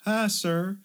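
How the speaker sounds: high chirpy greeting; polite barista. Retold or imitated. Barista greeting him politely, high chirpy. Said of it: "They're like".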